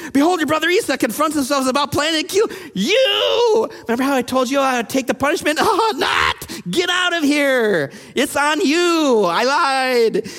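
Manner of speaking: high, frantic mock-panicked mother; over-the-top alarm, played for laughs; hammed-up panic. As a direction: shriek it, stretching the last words. Acting Rebekah panicking, going back on promise.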